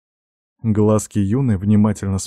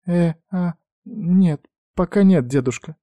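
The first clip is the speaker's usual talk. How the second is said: high, hesitant granddaughter; faltering voice; 9 semitones higher than usual. A narrator's reading. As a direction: stall and stammer nervously, pitched up. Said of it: "буксовал её голос".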